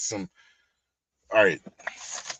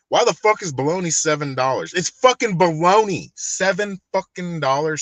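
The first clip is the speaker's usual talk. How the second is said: loud, high ranting about prices; worked-up shout over the price of bologna; yelling; 6 semitones higher, 5 dB louder than usual. Real, not acted. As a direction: yell it out in outrage. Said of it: "Sorry that I'm yelling".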